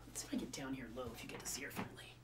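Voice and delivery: fast, soft whisper. Real, not acted. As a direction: hush so the dog stays calm. Sneaking low to show dog.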